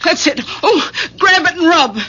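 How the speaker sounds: high, agitated orders through a cramp; pained impatience. Acted. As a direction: scold urgently while hurting. Wife in pain snaps at husband.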